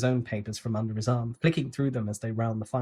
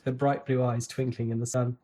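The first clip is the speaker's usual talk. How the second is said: ordinary delivery, nothing marked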